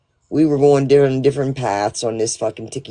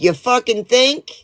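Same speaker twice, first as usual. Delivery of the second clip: sarcastic retort; 10 semitones higher than usual. Retold or imitated.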